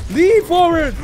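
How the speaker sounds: high, loud shout; battle cry. Real, not acted. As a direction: yell the attack as you pounce. Shouting the game move.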